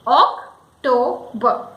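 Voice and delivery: OCTOBER slowly in parts, ok, TOE, buh; English pronunciation. Shown as a demonstration. Teacher breaking the word up, English pronunciation. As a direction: break OCTOBER into three slow syllables. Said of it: "we say it October".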